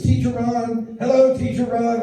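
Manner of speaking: put-on high, excited kid voice; high-pitched little heckler calling out; mimicked smart-aleck kid. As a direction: pipe up like a cheeky preschooler. Smart-aleck kid calls out his teacher.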